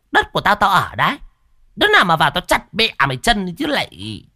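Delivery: high, loud aggressive threat; shrewish retort. Acted. Cúc threatening her sister-in-law, aggressive retort. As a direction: snap back and threaten her.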